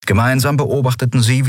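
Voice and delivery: ordinary delivery, nothing marked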